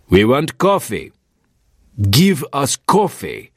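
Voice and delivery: a hint of menace in a stern warrior; harsh demand. A narrator's reading. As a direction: lean hard on the words, losing patience. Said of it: "This time he had a harsh edge to his voice".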